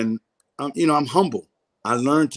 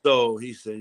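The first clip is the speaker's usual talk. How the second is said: ordinary delivery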